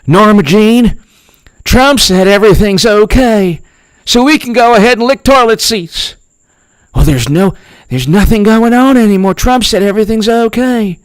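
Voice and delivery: cartoonish gee-whiz hillbilly voice; mock Trump supporter. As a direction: gush it like a naive country bumpkin. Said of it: "the average Trump supporter, uh, says".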